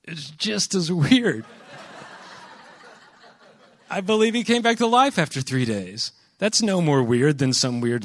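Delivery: high, loud, slow teasing retort; mock defensive. Retelling his own reply to the friend.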